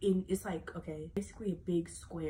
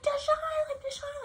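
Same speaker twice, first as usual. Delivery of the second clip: frantic calling; 6 dB louder than usual. Retold or imitated. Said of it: "she's going crazy".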